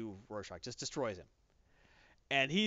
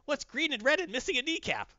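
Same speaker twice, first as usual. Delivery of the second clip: high, playful mock-villain voice, rising as it goes; Joker impression; 9 semitones higher than usual. Host voicing the Joker taunting the bound Comedian, high mocking tease. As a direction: tease like a giggly Joker telling a riddle. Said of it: "And Joker's like".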